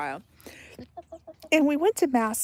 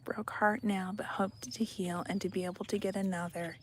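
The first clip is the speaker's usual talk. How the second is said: whisper; 8 dB quieter than usual. Real, not acted. Reading a viewer's comment.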